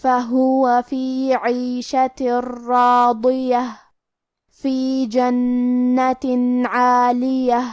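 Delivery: slow, melodic tajweed chant; Quran recitation. Acted. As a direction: recite each word drawn out like in prayer.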